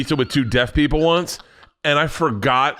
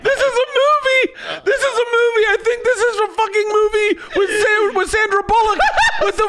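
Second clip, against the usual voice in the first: deaf cousin voice. Retold or imitated. Comedian imitates deaf cousin on the bus, high squeal. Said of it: "He's like".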